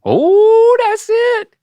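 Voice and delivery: long, high, loud "oh" of triumph; excited cheer. Real, not acted. Teacher to Dad as he nearly gets it, excited cheer. Cheers Dad's closer attempt.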